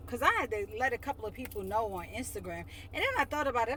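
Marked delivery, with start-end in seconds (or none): none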